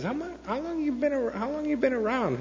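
high-pitched, put-on questioning voice; high mock interrogation; imitated old-timer.

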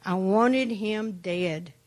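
usual speaking voice

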